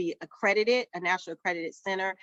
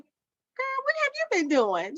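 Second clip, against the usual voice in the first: put-on much higher, sassy girl what have you been doing; CPA's amazed voice; slower than usual. Accountant amazed at the owner's success, high mock squeal. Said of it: "she's like".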